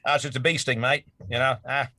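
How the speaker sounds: a laid-back Aussie shrugging off a sting; Aussie accent. Retold or imitated. Mocking how tough Aussies are in a laughing Australian accent. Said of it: "the Aussie accent".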